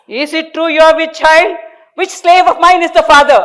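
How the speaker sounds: high, loud squeaky man; high squeaky accusing husband. A narrator's reading. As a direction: squeak it out indignantly. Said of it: "in his squeaky voice".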